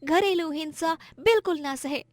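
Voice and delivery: high-pitched woman's voice giving praise; admiring customer. Acted. Customer praising divorced woman's courage, high and admiring.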